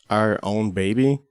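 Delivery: ordinary talk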